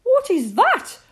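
high, loud regal queen voice; storyteller voicing the Queen, high and loud.

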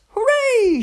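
high, loud cheer; shouting. A narrator's reading. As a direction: cheer at the top of the voice. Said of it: "shouted Mum".

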